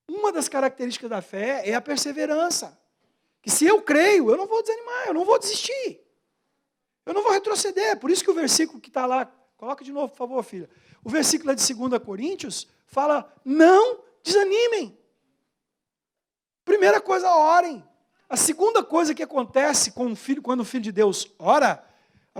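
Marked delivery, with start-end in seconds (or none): 3.4-8.0 high, insistent declarations of faith; emphatic preaching. Real, not acted. 13.4-17.8 high, loud exhortation; drawn-out, forceful preacher's charge; emphatic preaching. Real, not acted.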